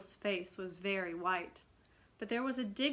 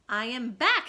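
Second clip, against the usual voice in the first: louder, indignant announcement; hot and indignant; 3 semitones higher, 11 dB louder than usual. A narrator's reading. Peter annoyed at the silent boys, hot indignant. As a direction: demand the welcome he deserves. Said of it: "he said hotly".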